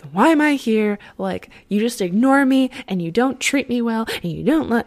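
a hammed-up outburst of grievance; raging wife. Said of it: "she flies into a rage".

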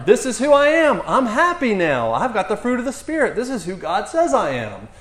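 loud, high, triumphant declaration; exuberant church testimony. Acted. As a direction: proclaim it like a new convert testifying. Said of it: "this lady at a Baptist church pulling a Dan Mohler testimony".

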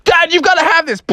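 a high, urgent plea; excited frog voice; eager kid. A narrator's reading. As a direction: push it on him with excitement. Froggy pushing sandwich on dad.

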